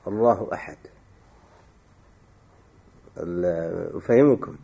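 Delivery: ordinary delivery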